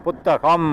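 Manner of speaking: புத்தகம் with the closing "m" hummed long; final consonant shown. Shown as a demonstration. Teacher giving an example of a consonant ending, held final sound. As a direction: hold on to the last ம் of புத்தகம். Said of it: "இம் இருக்கா".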